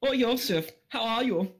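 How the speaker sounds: put-on high, camp German-accented voice. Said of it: "can't do a German accent without sounding incredibly camp".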